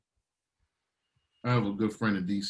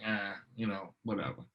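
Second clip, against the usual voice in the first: bored; 9 dB quieter than usual. Retold or imitated. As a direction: shrug it off. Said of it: "Some people like".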